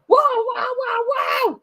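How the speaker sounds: shrill high squealing cries; screaming trucks. A narrator's reading. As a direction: squeal like panicked toy trucks. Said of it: "screamed the trucks".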